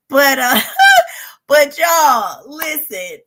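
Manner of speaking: loud laughter spilling into words; laugh, then shout it out. Real, not acted. Bursting laughter after joke.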